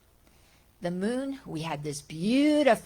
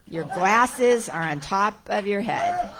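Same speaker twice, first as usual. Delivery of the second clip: laughing; 7 dB louder than usual. Real, not acted. Finding lost glasses on head.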